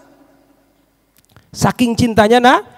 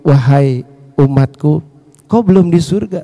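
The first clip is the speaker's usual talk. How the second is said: low, unhurried reply; Prophet answering; 6 semitones lower than usual. Acted. Prophet tells his follower it is not heaven, composed low voice. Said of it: "Lalu Nabi menjawab".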